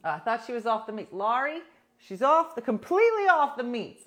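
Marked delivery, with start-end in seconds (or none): 1.1-4.0 louder, higher disbelief; exclaiming to a viewer about her friend; incredulous emphasis. Real, not acted. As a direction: stress completely in disbelief. Incredulous correction to commenter.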